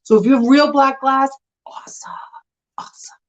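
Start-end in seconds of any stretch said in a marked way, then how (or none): 1.7-3.2 hushed, whispered "awesome"; whispered aside. Real, not acted. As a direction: drop to a bare whisper.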